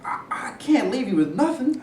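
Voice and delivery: soft unvoiced whisper, hesitant. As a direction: breathe it out gently. Quietly refusing the last food.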